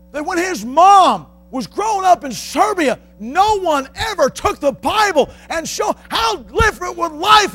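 high, loud shouted sermon, slowing at the end; impassioned preacher's shout; fiery preaching. Acted. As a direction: thunder it from the pulpit. Preaching at full force.

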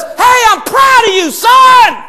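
high, loud exultant call; proud fatherly shout. Acted. The Father looks down on Jesus, proud jubilant shout. Voices the Father praising his Son.

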